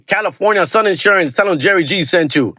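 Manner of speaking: fast-talking local ad pitchman; hype ad read. Acted. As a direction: sell it like a late-night radio spot.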